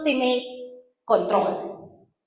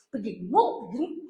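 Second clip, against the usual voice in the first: low, soft urging to hold back; calm a friend down under your breath; hushed restraining; 4 semitones lower, 8 dB quieter than usual. Retold or imitated. Calming a street fight.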